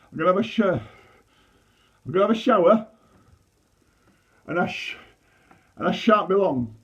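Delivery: high, loud stuttering tantrum, stuck on each start; a kid storming off, stammering hard; shouting stammer, stuck on sh-; stammered outburst. Acted. Stammering outburst in family argument.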